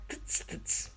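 two dry tongue clicks. A narrator's reading. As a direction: click your tongue twice in scorn. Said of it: "пощёлкал языком".

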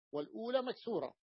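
ordinary talk, nothing marked

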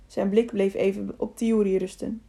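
ordinary delivery

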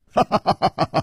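light rapid ha-ha laugh, pitched high; cheerful laugh. A narrator's reading. Hero relieved at the swordsman's gift, laughing. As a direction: laugh easily in a quick run. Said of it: "楚留香笑着说".

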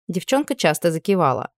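usual speaking voice

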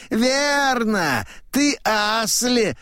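high, emphatic confirmation; high-voiced old man; old man declaring. A narrator's reading. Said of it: "говорит старик".

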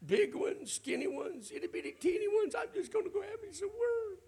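hammed-up whine, very high and loud; complaining. Someone complaining to God in prayer, hammed-up whiny wailing. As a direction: wail a mock self-pitying prayer, building to a crying squeal. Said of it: "nothing but you complaining".

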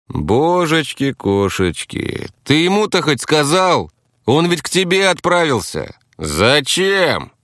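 high, wide-eyed astonishment; genuinely baffled, drawn-out start; sincerely amazed. A narrator's reading. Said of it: "искренне изумился Ловелас".

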